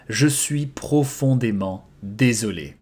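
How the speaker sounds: "Je suis profondément désolé" spoken slowly and clearly; slow model repetition. Shown as a demonstration. Teacher repeating the phrase slowly for students, slow. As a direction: draw it out for the learner. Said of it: "I am deeply sorry".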